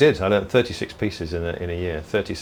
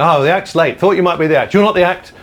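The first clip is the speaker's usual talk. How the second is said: loud, high, jolly compere calling from stage; hammed-up loud compere; compere's voice; 10 semitones higher, 8 dB louder than usual. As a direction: boom it out like a club compere. Compere Eugene voiced in anecdote.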